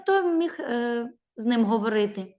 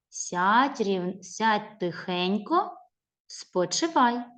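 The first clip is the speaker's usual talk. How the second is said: ordinary talk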